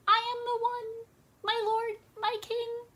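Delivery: tiny high-pitched bee voice; small bee. Acted. Small bee confesses to the king, high little voice. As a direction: squeak up meekly to a mighty king. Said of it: "a small bee".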